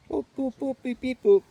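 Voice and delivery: high robot beeping; squeaky droid beep-boop noises. Pretend. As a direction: chirp it out like a little droid. Imitating R2-D2 droid beeps.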